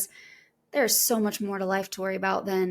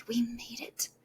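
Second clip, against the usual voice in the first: whispered; 3 semitones higher, 7 dB quieter than usual. Retold or imitated. Quiet proud inner voice.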